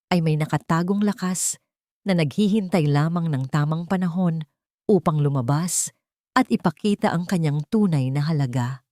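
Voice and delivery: plain reading voice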